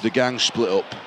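low, lightly acted Yorkshire boss accent; broad Yorkshire accent. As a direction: report it like a Yorkshire mafia boss. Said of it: "I'm a Yorkshireman in New York".

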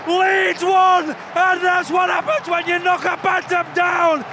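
high, loud, thrilled play-by-play; excited shouting commentary. Real, not acted. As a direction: shout the call to the fans. Live commentary after equaliser.

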